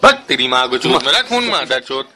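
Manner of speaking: ordinary delivery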